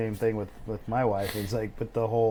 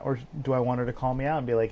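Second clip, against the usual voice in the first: ordinary talk, nothing marked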